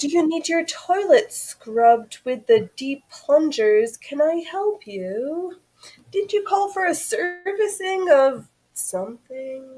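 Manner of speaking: high, singsong offer of help; fake service worker. Bluffing as a plumber offering service, high put-on voice. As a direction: pose as a chirpy caller at the door.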